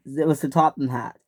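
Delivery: ordinary delivery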